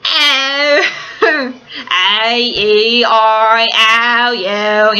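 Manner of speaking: put-on stretched-out vowels in old-fashioned Cockney; exaggerated stage Cockney vowel sounds; My Fair Lady Cockney. Shown as a demonstration. Showing fans My Fair Lady Cockney, exaggerated Cockney. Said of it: "like, My Fair Lady Cockney, which is like".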